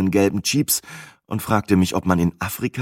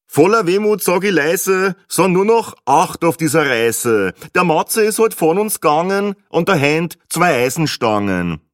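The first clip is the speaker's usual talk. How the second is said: higher, sing-song Austrian doggerel; cheap Viennese rhymester; 7 semitones higher than usual. A narrator's reading. Joker rhyming about the narrator's death, hammed-up Viennese doggerel. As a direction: recite mock-mournful rhymes in Viennese dialect. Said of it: "durch seinen billigen Reimwolf drehen".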